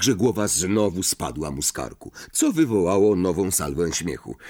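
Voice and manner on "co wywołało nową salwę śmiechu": plain reading voice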